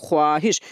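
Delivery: ख्वाहिश as kho-aa-hish, the wav sounded; spelling pronunciation. Shown as a demonstration. Teacher showing what is written, ख्वाहिश with its wav sounded. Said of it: "ख्वाहिश लिखते हैं".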